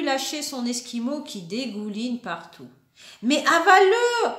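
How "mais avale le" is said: loud, high command; ordering. Acted. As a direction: snap the order at the child. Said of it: "ordonne maman".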